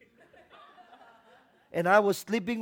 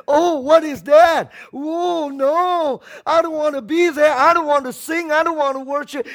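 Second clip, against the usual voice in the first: loud, high, whiny mock complaint; mock a grumbling member; mock reluctant churchgoer; 9 semitones higher, 11 dB louder than usual. Pastor mocking reluctant worshippers, whiny shout. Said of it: "We cannot come and say".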